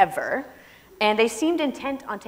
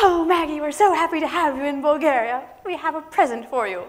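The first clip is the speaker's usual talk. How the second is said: effusive host; 8 semitones higher, 4 dB louder than usual. Retold or imitated. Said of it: "host greets her on stage".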